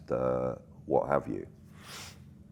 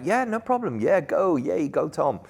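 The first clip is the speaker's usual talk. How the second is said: much higher, sing-song and falsely keen; fake cheery; 12 semitones higher, 3 dB louder than usual. Crew humour Tom about his lap with mock cheer. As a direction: beam a sugary yes you don't mean.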